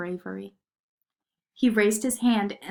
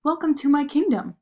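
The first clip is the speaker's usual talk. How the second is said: higher, louder grand welcome; prince's welcome; 6 semitones higher, 6 dB louder than usual. A narrator's reading. The prince welcoming Marie to his kingdom, grandly. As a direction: welcome her proudly as a prince. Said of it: "said the prince".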